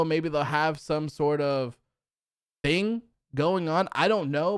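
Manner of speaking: usual speaking voice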